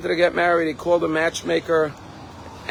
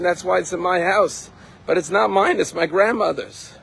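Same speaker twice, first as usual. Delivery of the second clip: higher-pitched, insistent retold explanation; emphatic, raised voice of the wronged girl; indignant protest; 5 semitones higher than usual. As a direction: set the record straight, indignantly.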